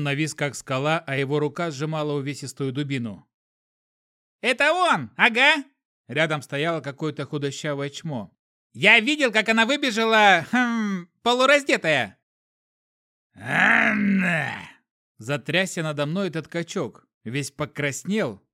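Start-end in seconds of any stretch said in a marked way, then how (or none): 4.4-5.6 high, loud excited tattler; shrill snitch. A narrator's reading. Weaselly bystander accuses. 8.7-12.2 high, loud excited tattler; shrill snitch. A narrator's reading. Weaselly bystander accuses. 13.3-14.5 loud, high outraged cry; anguished shout. A narrator's reading. Brute cries wife's name.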